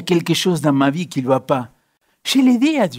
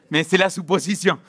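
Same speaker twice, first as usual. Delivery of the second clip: usual speaking voice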